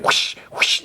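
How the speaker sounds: two voiceless swishes; imitated whip whoosh. Siphon cracking his flame whip at them, imitated whooshes. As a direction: make the sound of a flame whip. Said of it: "kinda making a whip".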